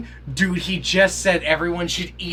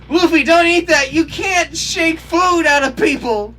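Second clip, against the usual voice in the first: much higher, loud, slowed at the end; alarmed yell; 12 semitones higher, 10 dB louder than usual. Real, not acted. Viewer yelling at Luffy not to eat it, alarmed yell. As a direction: shout a warning at the screen.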